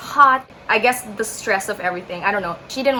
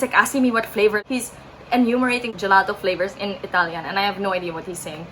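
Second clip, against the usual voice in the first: ordinary talk, nothing marked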